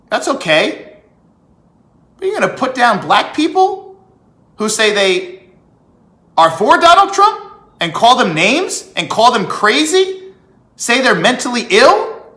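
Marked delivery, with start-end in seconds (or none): 2.2-12.2 higher and louder, building outrage; real heated disbelief; indignant outburst. Real, not acted. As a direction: let the indignation spill over. Indignant rant about critics.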